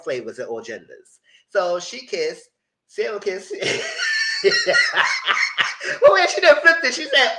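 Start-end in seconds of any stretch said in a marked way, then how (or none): 3.6-5.9 high squealing cackle; real high shrieking laughter at the flip. Real, not acted. Burst of laughter.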